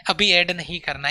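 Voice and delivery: ordinary talk, nothing marked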